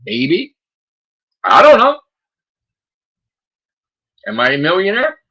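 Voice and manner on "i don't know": loud exasperated. Real, not acted. Shrugging off the question.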